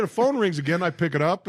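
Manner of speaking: ordinary delivery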